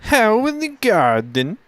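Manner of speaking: "How in the garden" in a high, slow mock German accent; German accent, high, stretched. Shown as a demonstration. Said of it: "You sounded more like a".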